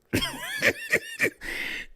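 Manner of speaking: high wheezing cackle; real, helpless high laugh. Real, not acted. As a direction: burst out in a high-pitched laugh. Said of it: "I'm laughing 'cause y'all".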